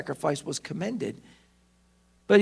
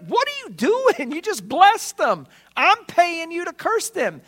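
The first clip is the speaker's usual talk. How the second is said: high, slow, indignant mock outburst; a king scolding the prophet he paid, high and indignant; irate king; 9 semitones higher, 4 dB louder, slower than usual. Preacher voices the king berating Balaam in a high, slow, indignant outburst. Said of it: "getting irate".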